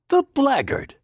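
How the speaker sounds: high, loud indignant outburst; indignant exclamation; indignant cry. A narrator's reading. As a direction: spit out the insult in outrage. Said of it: "I cried indignantly".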